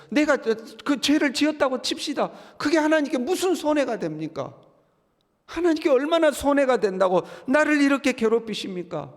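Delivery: high-pitched acted lament to God; impassioned pleading. Job asking God what harm his sin does, impassioned pleading. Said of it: "하고 지금 하나님께 하소연하고 있는 거죠".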